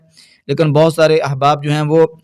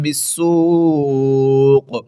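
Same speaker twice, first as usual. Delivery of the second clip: slow, drawn-out melodic tilawat; Quran recitation; 2 semitones higher, much slower than usual. Acted. Recites the verse. (ترتیل کے ساتھ تلاوت)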